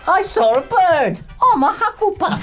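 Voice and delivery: high silly voice mocking the gang; dopey gang member. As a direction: play a simple-minded thug proudly announcing himself. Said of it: "Captain Hearthstone's Gang of Mediocrity be like".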